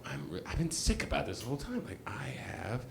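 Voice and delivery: hushed, secretive whisper; whispering a secret to a friend; whispered confession. Retold or imitated. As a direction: whisper it so nobody else hears. Confessing secretly to Kate backstage.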